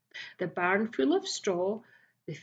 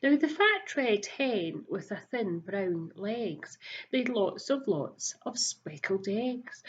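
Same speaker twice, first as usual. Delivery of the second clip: plain reading voice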